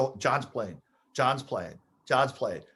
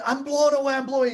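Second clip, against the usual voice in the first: high, loud yell of disbelief; excited screaming; 8 semitones higher, 3 dB louder, faster than usual. Retold or imitated. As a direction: shout it as you run off stunned. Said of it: "Alpo screaming".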